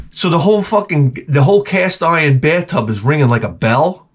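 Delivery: normal talking voice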